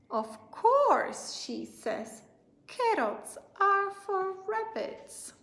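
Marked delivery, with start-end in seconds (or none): 0.1-1.2 put-on high, loud, matter-of-fact little girl. Lola to her brother, a high indignant little girl. 2.7-5.3 put-on high, loud, matter-of-fact little girl. Lola to her brother, a high indignant little girl.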